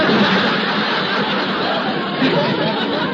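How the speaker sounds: wheezing high laugh at his own gag; high breathless laugh. Real, not acted. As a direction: burst out laughing at your own joke. Laughs at his own golf bag joke.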